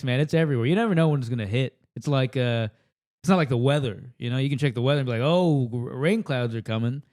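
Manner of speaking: usual speaking voice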